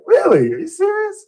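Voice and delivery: high, loud retold burst of surprise; amazed disbelief. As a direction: act out his astonishment, pitch leaping up. Retold astonished reaction to shared address.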